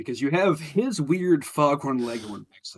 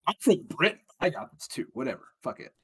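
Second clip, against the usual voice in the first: imitated casual shrug, fading softer; imagined actor not bothering, getting quieter; mock offhand; 2 semitones lower, 7 dB quieter than usual. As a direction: toss it off, trailing away quietly.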